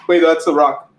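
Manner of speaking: usual speaking voice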